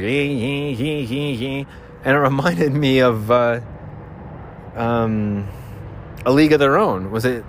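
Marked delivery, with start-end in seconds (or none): 0.0-1.7 string of imitated "hee" noises. Host showing the thing he just did, imitated hee-hee. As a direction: repeat light hees, imitating an earlier sound. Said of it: "did that thing where I was like".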